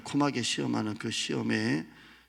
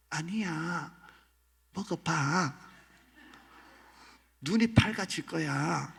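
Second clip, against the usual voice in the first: higher, wheedling tempter; sly devil voice; 5 semitones higher, slower than usual. Acted. Devil coaxing Eve to eat the fruit. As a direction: lure her in with a knowing smile.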